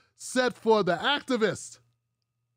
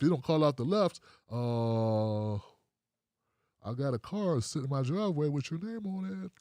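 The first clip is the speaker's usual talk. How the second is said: imitated briber; 3 semitones lower than usual. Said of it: "It's not like".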